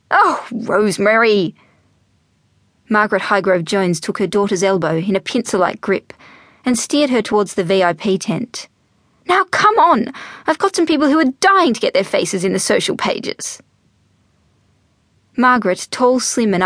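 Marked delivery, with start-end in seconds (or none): 0.1-1.5 slow, drawn-out dismay; appalled mother. Acted. Mother appalled at daughter's crude remark, exasperated. 9.3-13.6 high-pitched urging; bossy, impatient mother. Acted. Mother steers daughter towards V I P tent, impatient.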